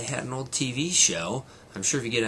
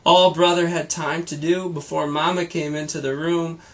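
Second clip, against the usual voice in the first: lively read-aloud storytelling voice; brighter, fuller storybook reading; story narration; 6 semitones higher, 8 dB louder than usual. A narrator's reading. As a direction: read it like a bedtime story to a child. Reads story to child.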